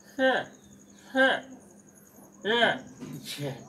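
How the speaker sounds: sounds pushed out loud and clear; correct pronunciation demo. Shown as a demonstration. Teacher demonstrating pure sounds to students. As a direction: pronounce each sound with force. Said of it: "ये शुद्ध उच्चारण होगा".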